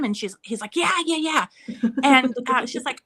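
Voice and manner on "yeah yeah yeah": high-pitched imitation of an excited kid; rapid-fire, higher kid voice; eager agreement. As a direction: agree like a keen little boy. Son eagerly agrees, acted out.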